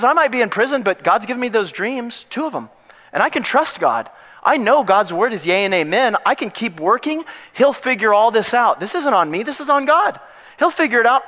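high, upbeat declaration of trust; acted confident prisoner, pitched high; totally confident. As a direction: speak up with unshakable faith. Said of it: "he's totally confident".

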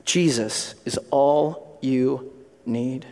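very slow, low delivery; solemn key message. Real, not acted. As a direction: let each word land slowly.

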